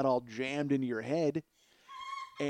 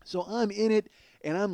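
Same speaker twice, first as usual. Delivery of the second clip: usual speaking voice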